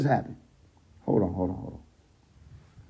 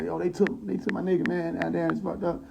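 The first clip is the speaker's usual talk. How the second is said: higher, softer complaining voice; mild acted lament; 4 semitones higher, 9 dB quieter than usual. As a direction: lightly play an upset woman lamenting. Voicing the crying woman's complaint.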